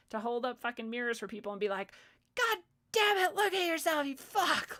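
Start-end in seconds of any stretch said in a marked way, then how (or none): 2.3-4.8 much higher, louder put-on shout; mock tough-love yell. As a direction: blast them with a mock rant.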